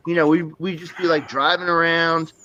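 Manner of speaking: ordinary delivery, nothing marked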